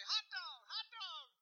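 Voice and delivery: very high, falling exclamation; excited brother's cry. Retold or imitated. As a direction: jump up and yelp it. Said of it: "He'd stand up and say".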